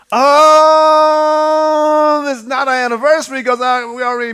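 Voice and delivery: a long, high, loud Oh of a wife catching on; mock dismay. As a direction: stretch the Oh in sudden dismay. Wife reacting, quoted.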